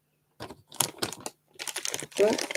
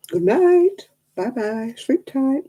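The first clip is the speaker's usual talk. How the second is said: sing-song goodnight; 6 semitones higher, slower than usual. Real, not acted. Signing off to Craftnitch.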